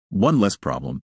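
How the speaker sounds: plain reading voice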